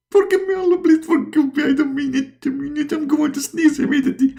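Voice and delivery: very high begging voice; pleading Muhammad. Acted. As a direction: beg frantically for forgiveness.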